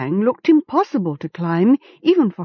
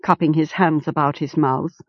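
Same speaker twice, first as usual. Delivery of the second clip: usual speaking voice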